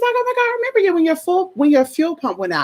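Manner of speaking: high, fast, gushing recognition; excited tow driver. Retold or imitated. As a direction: bubble over like a man spotting an old customer. Tow driver greets her excitedly.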